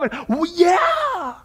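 stretched triumphant cry of joy; excited shout. Real, not acted. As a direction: shout it out in pure delight. Preacher rejoicing over the promise.

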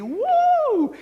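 high joyful whoop; a sudden sky-high woo from the pulpit; excited whoop. Real, not acted. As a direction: let out a jubilant cry. Preacher celebrating victory over death.

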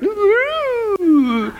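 high drawn-out "ooh" sliding down; comic wailing "ooh" for the fish; imitated fish noise. Preacher imitates fish going through a ghost figure, comic wail. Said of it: "and the fish went".

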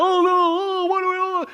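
high, fretful wail of helpless believers; mock panic. Preacher mocking panicked believers, fretful whine. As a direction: whine it like a lost churchgoer.

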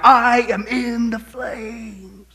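slow, drawn-out agonized wail; anguished cry. Retold or imitated. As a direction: stretch it out in torment. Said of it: "crying out".

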